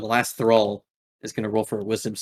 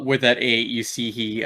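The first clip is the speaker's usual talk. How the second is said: ordinary talk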